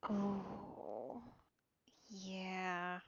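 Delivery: ordinary delivery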